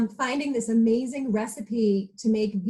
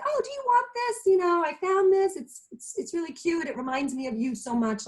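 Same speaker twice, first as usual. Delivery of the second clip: higher, sweet mom voice offering a gift; imitating mom; 7 semitones higher than usual. Retelling how her mom offered the ring, higher sweet imitation. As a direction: lift the voice like a mother gently offering. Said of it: "But she said".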